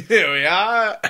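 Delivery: slow, high, mock British reporter signing on. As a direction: ham up a posh British correspondent, drawn out. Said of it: "the biggest British voice you've ever heard".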